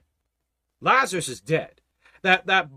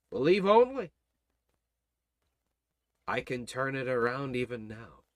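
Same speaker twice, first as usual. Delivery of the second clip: reassuring Lord; 7 semitones lower, 5 dB quieter than usual. Acted. Preacher voices the Lord.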